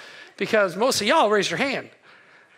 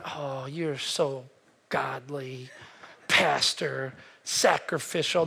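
lower, softer, slow sarcastic purr; mock praise; 7 semitones lower, 5 dB quieter, much slower than usual. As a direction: drip fake admiration, dropping to a whisper. Mocking congregation praising pastor.

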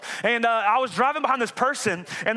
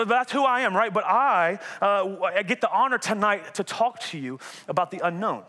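ordinary talk

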